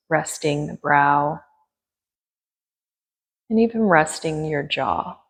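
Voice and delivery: gentle yoga teacher guiding breath and attention; guided meditation. Acted. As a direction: ease them into stillness with long pauses.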